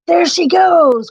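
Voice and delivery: high, loud shout; cried out. A narrator's reading. Grumpy spotting the fleeing queen, loud shout. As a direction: call the others to chase. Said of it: "cried Grumpy".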